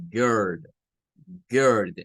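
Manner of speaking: put-on «girl» con vocal entre U y E; vocal mezclada. Shown as a demonstration. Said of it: "Pareciera un sonido que es una mezcla". («girl» con sonido ER inglés)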